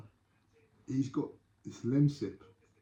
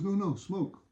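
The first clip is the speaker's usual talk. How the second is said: higher-pitched insistent foreign visitor; acted tourist; 8 semitones higher, slower than usual. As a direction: repeat it as a tourist not understood. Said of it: "He's a tourist".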